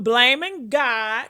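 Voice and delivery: ordinary talk, nothing marked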